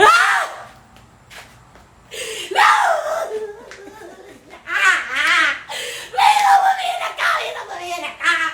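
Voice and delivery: mock hysterical screaming. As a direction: scream like being forced into the car. Screaming to not enter car.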